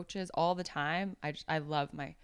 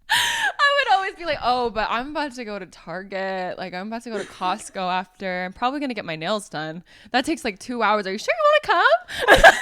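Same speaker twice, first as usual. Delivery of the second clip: laughing; 6 semitones higher, 9 dB louder than usual. Retold or imitated. Retold excuse to tag-along friend.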